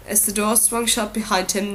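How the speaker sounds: ordinary delivery, nothing marked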